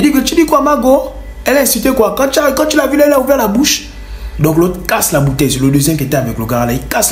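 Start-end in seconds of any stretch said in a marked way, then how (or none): 0.0-3.9 high, pushy street tough; imitating the bandit. Bandit pressing him about the girl, high pushy. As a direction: lean in and provoke.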